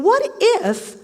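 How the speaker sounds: pitched high, sudden spark of possibility; acted wondering. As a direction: lift WHAT IF up high, as a daring thought. Acting out a wondering thought.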